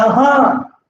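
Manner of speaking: ordinary talk, nothing marked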